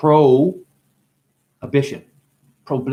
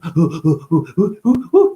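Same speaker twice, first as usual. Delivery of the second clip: gasping laugh; 3 semitones higher, 4 dB louder than usual. Real, not acted.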